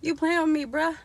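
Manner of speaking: high New York street challenge; New York slang. Retold or imitated. Vlogger imitating New York talk, high teasing challenge. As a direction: tease it New York style. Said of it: "What they be saying in New York".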